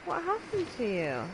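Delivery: ordinary delivery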